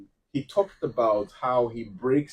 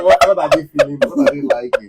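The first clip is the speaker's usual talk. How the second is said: high, loud exaggerated disbelief; mock bafflement; 13 semitones higher, 12 dB louder than usual. Reacting to fans of the voice, hammed-up high bafflement. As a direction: act utterly baffled by the fuss.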